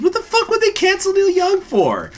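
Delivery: high, loud disbelieving outburst; incredulous retort. Retold or imitated. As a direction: blurt it out in shocked disbelief. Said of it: "I said".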